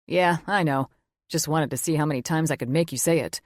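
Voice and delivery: lightly voiced gruff teasing father; teasing dad. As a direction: rib her like a proud dad.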